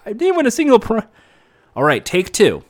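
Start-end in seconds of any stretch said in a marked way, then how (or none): 0.1-1.1 put-on upset kid. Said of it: "You're like".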